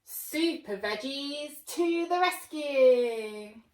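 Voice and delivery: loud, slow grand announcement; booming slow proclamation; heroic call. A narrator's reading. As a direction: trumpet the heroes.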